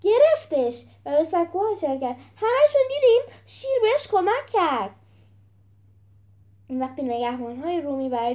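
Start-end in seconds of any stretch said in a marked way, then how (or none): 0.0-0.8 raised, higher-pitched holler; loud, indignant cry. A narrator's reading. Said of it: "پیروزمرد هوار زد". 2.4-4.9 very high, loud protest to the crowd; shrieked accusation; outraged hollering. A narrator's reading. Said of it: "پیروزمرد هوار زد".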